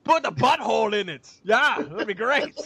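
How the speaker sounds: put-on pleased with himself in a Scottish accent; mock Scottish writer, Shrek-like. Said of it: "fucking sounds like Shrek".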